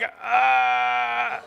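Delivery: long, high, level "uhhh"; imitated strange noise. Mugger frozen after the shots, imitated long groaning noise. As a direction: imitate a frozen man's drawn-out groan, held on one high note. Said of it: "he's making a noise".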